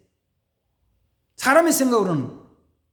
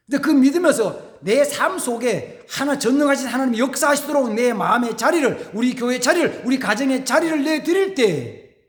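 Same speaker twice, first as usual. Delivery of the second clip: fervent preaching; 5 semitones higher than usual. Real, not acted. Preaching builds to climax.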